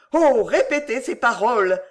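impassioned exclamation; exclaiming. A narrator's reading. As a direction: burst out with an eager plea. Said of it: "s'écria-t-il".

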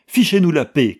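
loud, high, rude dismissal; imitated shout. Manske quotes the brutal police chief, mock shouting. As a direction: mimic an officer shouting him away. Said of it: "cria cet homme".

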